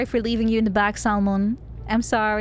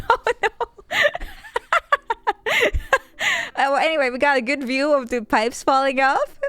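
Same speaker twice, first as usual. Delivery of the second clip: laughing while talking; 3 semitones higher than usual. Real, not acted. Laughing through words.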